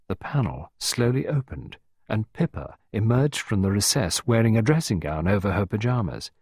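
usual speaking voice

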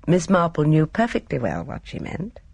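ordinary delivery, nothing marked

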